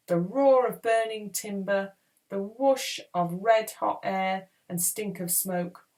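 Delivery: usual speaking voice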